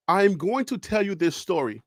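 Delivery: ordinary talk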